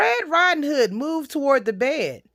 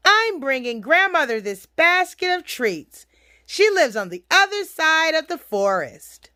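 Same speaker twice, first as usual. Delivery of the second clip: put-on higher, innocent child voice; little girl; 5 semitones higher than usual. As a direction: chirp it as a trusting little girl.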